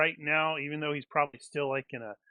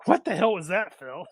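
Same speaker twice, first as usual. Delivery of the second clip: incredulous, higher and louder; mock baffled; 4 semitones higher, 5 dB louder than usual. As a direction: everyone reacting in disbelief. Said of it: "Everybody's like".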